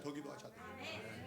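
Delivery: 더 기도하셔야 돼요 whispered, unvoiced; whispered urging. Shown as a demonstration. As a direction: breathe it as a whisper.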